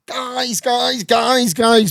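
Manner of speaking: lightly put-on London accent, hailing the lads; mimicking the London director rounding up the crew. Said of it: "proper, like, London type like this".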